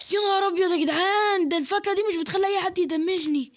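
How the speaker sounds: high, loud cry of amazement; astonished shout. Real, not acted. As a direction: yell in disbelief at what just happened. Shocked by fruit's power.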